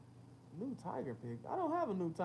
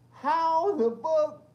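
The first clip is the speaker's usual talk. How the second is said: slow, high, loud drawn-out question; stunned disbelief; 9 semitones higher, 10 dB louder, much slower than usual. Retold or imitated. Seeing cars on the other side, slow loud disbelief. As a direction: stretch it out in shock. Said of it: "I'm like".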